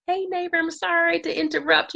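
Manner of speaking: high, soft and apologetic; timid knock-at-the-door greeting; sheepish. Retold or imitated. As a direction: tiptoe in with a sorry little voice. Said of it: "I sheepishly knock on her door".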